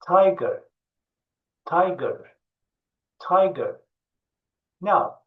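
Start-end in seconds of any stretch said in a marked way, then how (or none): 0.1-3.8 tiger said clearly as "tai-ger"; tiger, repeat it like a teacher; careful pronunciation. Shown as a demonstration. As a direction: tiger for kids to copy. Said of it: "Okunuşuma dikkat et".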